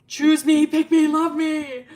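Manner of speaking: high, drawn-out hammed-up begging; mock pleading. Teller mocks Milo's plea, with hammed-up begging. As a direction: beg for love in a loud, silly plea.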